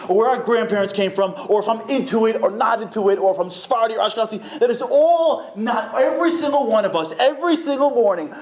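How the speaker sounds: high-pitched, fervent sermon climax; exhorting the congregation in a strained high voice; impassioned preaching. Real, not acted. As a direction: preach it with rising urgency, pitch held high. Preaches the moral at full force.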